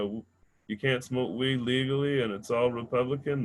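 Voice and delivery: ordinary delivery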